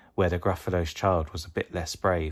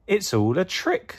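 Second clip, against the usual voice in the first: 1 it's a trick; 12 semitones higher than usual. Acted. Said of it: "said the Gruffalo's child".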